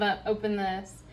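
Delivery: ordinary talk, nothing marked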